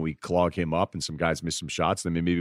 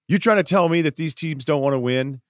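imitating Reggie Miller; 9 semitones higher, 4 dB louder than usual. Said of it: "Reggie Miller saying".

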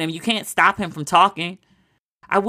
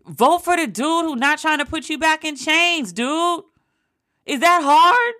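exasperated; 9 semitones higher than usual. Real, not acted. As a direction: throw up your hands at him. Exasperated rant at undecided voter.

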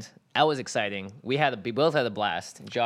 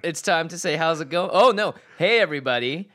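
excited; 7 semitones higher, 6 dB louder than usual. Real, not acted. Show intro, hyped.

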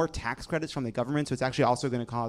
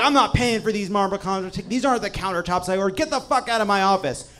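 angry customer; 7 semitones higher, 6 dB louder than usual. Retold or imitated. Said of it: "and like".

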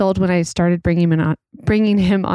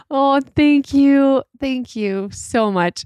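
mock gracious thanks; 7 semitones higher, slower than usual. Mock thanks to her daughter.

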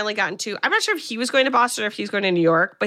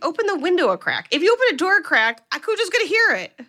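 exasperated yelling at the screen; 9 semitones higher, faster than usual. Retold or imitated. Talking back at Donna in the movie, high exasperated urging. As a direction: shout advice at a doomed heroine. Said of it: "I was like".

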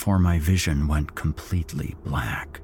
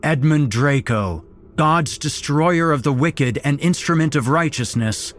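high, loud zealot's proclamation; loud fervent cult leader call; cultist shouting; 8 semitones higher, 4 dB louder than usual. A narrator's reading. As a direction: boom it out like a fanatic preacher. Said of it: "one of them shouted".

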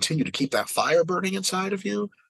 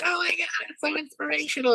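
very high-pitched fawning fan; mock gushing. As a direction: gush like an overcome audience member, sending them up. Mimics gushing award-show audience.

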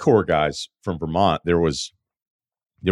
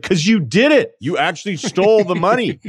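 high, louder, incredulous accusation; accusing retort; 11 semitones higher, 4 dB louder than usual. Retold or imitated. Friend snaps back at the crying wife, high incredulous accusation. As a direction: call her out, pitched way up.

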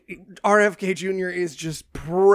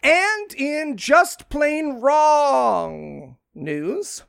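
high, hugely drawn-out headline; mock-grand newscast intro, very slow; segment announcer; 7 semitones higher, much slower than usual. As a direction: stretch out the segment title theatrically. Announces the next segment title.

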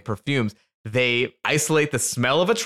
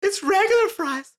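ecstatic over truffle fries. Retold or imitated. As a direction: moan it like the best thing ever. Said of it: "But she was like".

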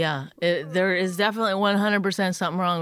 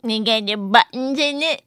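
mock the question back in a put-on voice; mocking mimicry; 3 semitones higher than usual. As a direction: tease by parroting the other person. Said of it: "Why would you make fun".

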